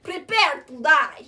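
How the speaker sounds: loud, drawn-out mock threat; hammed-up menace. Avenger facing his enemy, hammed-up menace. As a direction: stretch out the threat, loud and hammy.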